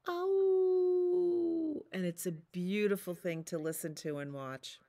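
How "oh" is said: high long throaty howl; wolf howl. Retold or imitated. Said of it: "they go".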